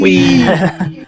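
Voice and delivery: high mock "Whee!"; playful squeal. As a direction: echo the squeal teasingly. Echoing the gleeful cry.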